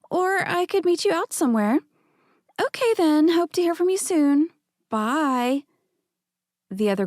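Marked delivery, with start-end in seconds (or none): 0.1-5.6 put-on higher, breathy-sweet voicemail from a club girl.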